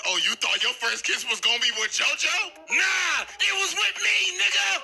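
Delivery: climbing to a high shouted gloat; taunting villain. Acted. Dio taunting Erina after kiss.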